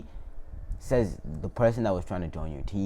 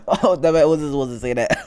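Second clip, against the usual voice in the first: blurted louder, higher self-correction; startled outburst; 5 semitones higher, 7 dB louder than usual. Real, not acted. Storyteller catching his own slip, startled loud outburst. Catches himself saying forbidden word.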